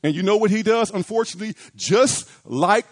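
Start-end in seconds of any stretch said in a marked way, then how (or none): none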